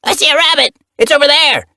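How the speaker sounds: put-on high, loud, eager young cat; excited kitten voice. A narrator's reading. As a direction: squeal it out like a thrilled kitten.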